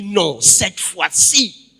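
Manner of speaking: loud, drawn-out cry of approval; emphatic acted exclamation. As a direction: proclaim it slowly and forcefully, as the man. Acts the man's emphatic exclamation. (exclamation forte et appuyée)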